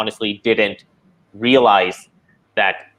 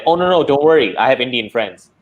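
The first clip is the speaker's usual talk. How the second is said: mock-reassuring, higher and louder at the start; breezy dismissal; 4 semitones higher, 5 dB louder than usual. Coworker brushing off a complaint about the accent, mock-breezy. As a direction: imitate someone loudly waving away a complaint.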